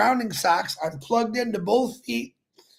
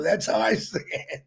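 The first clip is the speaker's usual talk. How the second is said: ordinary talk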